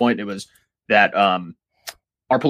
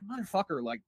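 ordinary talk, nothing marked

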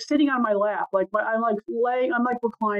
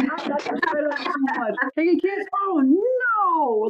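replaying niece's lines; 6 semitones higher, slower than usual. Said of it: "but she".

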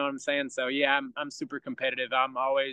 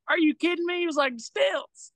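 high-pitched, amazed recognition; excited fan impression; 12 semitones higher than usual. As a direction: mimic a starstruck fan squealing in disbelief. Retold fan excitedly recognizing him.

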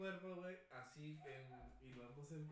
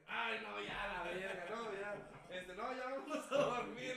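higher, louder fed-up plea, slowing at the end; hammed-up disgust, begging to go to sleep; exasperated protest; 6 semitones higher, 8 dB louder, slower than usual. Telling girlfriend after her fart, louder exasperated plea. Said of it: "le digo".

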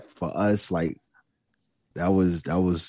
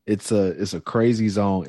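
usual speaking voice

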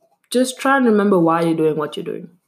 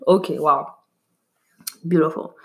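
normal talking voice

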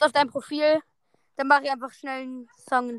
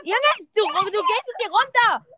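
angry yelling; 8 semitones higher than usual. Real, not acted. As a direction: chase him out of the room, furious. Yelling at someone to leave.

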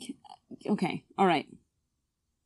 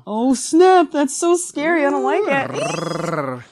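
hammed-up fright, higher and louder; mock scared; 6 semitones higher, 6 dB louder, slower than usual. Player pretends panic about her slim lead, in a mock scared voice. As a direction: play at being scared of losing. Told she's only up by one.

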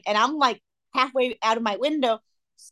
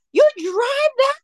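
retold heckling; 5 dB louder than usual. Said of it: "saying all these names to him".

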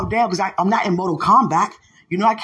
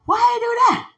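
high, louder disbelief; incredulous; 13 semitones higher, 4 dB louder, faster than usual. Retold or imitated. Speaker asking God about the orphans' parents, incredulous. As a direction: demand an answer, astonished.

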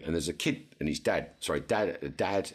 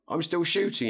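exasperated; 13 semitones higher, 4 dB louder than usual. Retold or imitated. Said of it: "I went".

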